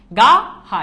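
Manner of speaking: "গা, হা" said as two separate, loud syllables; syllables split apart. Shown as a demonstration. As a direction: break "গা" and "হা" apart with a pause. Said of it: "এখানে দুইটা অক্ষর". ("গা, হা" দুই অক্ষর আলাদা করে জোরে)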